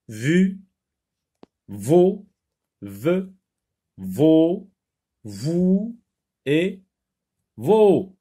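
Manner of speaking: "Vu, vaut, veut" high, one at a time, rising; syllable drill. Shown as a demonstration. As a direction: read "Vu, vaut, veut" separately, dropping only on the last. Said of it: "On relit".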